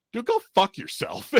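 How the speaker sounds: high, loud retold comeback; defiant outburst. Teen telling off the investigator, defiant. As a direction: throw it back at him, voice jumping up.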